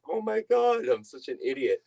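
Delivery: high burst of retold self-reproach; mock dismay. Telling viewers how she reacted to missing the auction, high mock dismay. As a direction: shoot up high on oh my God, then ease down.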